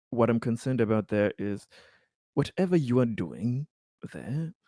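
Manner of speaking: usual speaking voice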